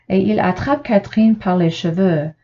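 ordinary delivery, nothing marked

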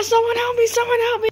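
high panicked call; screaming for help. Acted. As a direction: shriek for help. Calling for help.

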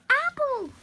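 high, loud cry of a name; calling out. Acted. Pony spotting her friend, calling out high and loud. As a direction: call across the field to her.

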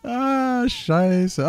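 high curse coming out of laughter; amused sigh. Real, not acted. Nach einem Lachanfall. (hoch seufzend nach dem Lachen)

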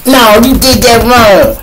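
high, loud whiny correction; mocking a troll. Mocking trolls correcting his story, high whiny voice. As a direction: whine it like a smug troll. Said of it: "We got a lot of trolls".

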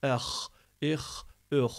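"Eh, ih, üh" with long scraped kh endings; rasping H. Shown as a demonstration. Teacher showing the vowelless letter, raspy "Eh, ih, üh". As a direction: rattle the breath at the back on each H. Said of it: "hırıltı yaparak".

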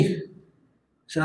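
ordinary delivery

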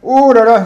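mock angry exclamation. Imitating sister angry.

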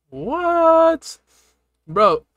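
normal talking voice

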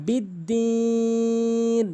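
বিদ্দিন with the vowel held very long before stopping; long drawn বিদ্দিন; three-alif stop. Shown as a demonstration. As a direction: stretch বিদ্দিন three alifs then stop. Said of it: "তিন আলিফ টেনে ওয়াকফ".

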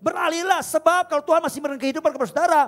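loud, high exhortation; full-force preaching. Real, not acted. Preacher urging the church to trust God, at full force. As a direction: preach it hard to the congregation.

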